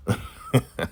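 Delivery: high, breath-only snicker; short amused cackle; gloating chuckle. Real, not acted. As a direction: snicker at someone's downfall. Gloats over deserved punishment.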